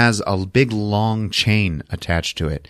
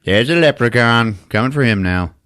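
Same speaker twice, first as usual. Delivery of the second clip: normal talking voice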